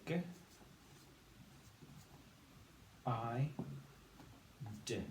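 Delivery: G, I, D as separate phonics sounds, hard G, long I; sounded out. Shown as a demonstration. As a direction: say G, I, D one sound at a time with long gaps. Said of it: "And we say".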